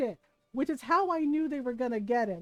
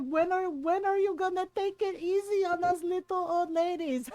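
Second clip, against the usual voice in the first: higher, mocking aunt voice; teasing roast; 4 semitones higher than usual. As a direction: needle him in a put-on voice. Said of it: "the way my mom and my titas roasted him".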